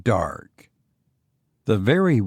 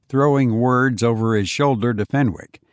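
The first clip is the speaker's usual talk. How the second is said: usual speaking voice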